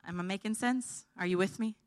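ordinary talk

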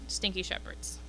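breathy whispered joke, half under the breath; a conspiratorial whispered dig at the shepherds; whispered aside. Acted. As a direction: lean in and whisper it like a cheeky secret. Comic aside about smelly shepherds.

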